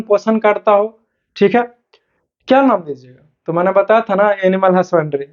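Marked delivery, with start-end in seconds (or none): none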